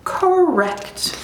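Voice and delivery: high, self-assured little lion; smug lion cub. Acted. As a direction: answer high, as the one in charge. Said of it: "the lion cub says".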